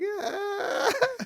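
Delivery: loud, high put-on laugh; imitated War Dogs laugh. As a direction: laugh mockingly, high and drawn out. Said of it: "know the wee laugh out of War Dogs".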